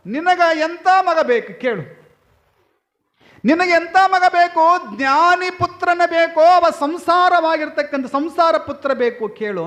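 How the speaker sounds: high, ringing voice of a guru; loud proclamation. Acted. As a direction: call out reassurance to the couple like a holy man. Guru offers the couple a son.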